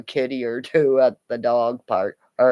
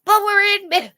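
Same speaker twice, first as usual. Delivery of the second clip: whiny protest; 5 dB louder, faster than usual. Retold or imitated. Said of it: "Kramer's going".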